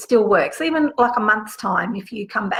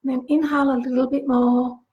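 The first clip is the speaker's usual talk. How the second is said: guided meditation. Acted. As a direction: guide them gently into the visualization. Leads a guided visualization.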